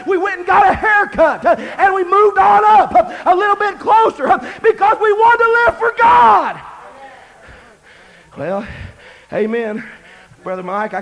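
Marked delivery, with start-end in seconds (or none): 0.1-6.6 higher, louder preaching climbing to a peak; preaching at full force. Acted. Preacher telling the youth how they got haircuts, rising to a full shout. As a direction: build it up to a shouted praise.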